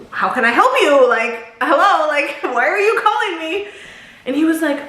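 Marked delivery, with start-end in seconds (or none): 0.1-3.7 higher-pitched, incredulous mock demand; annoyed questioning. Asking an unwelcome caller why he rang, acted annoyance. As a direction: climb in pitch, pointedly asking what he wants.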